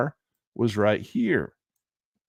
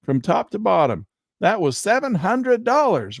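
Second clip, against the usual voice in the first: high, amazed gushing over a big move; mock wide-eyed delight at the chart; excited gush; 9 semitones higher than usual. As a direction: gush like a dazzled newbie trader. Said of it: "and you're like".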